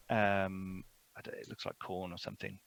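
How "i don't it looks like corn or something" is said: ordinary delivery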